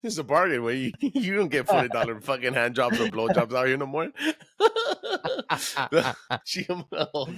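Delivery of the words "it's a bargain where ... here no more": real laughing speech. Real, not acted. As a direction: talk through laughter. Laughing through the comment.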